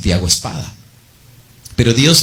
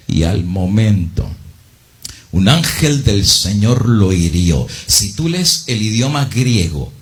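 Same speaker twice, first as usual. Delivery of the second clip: ordinary talk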